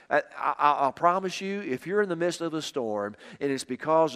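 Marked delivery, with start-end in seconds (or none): none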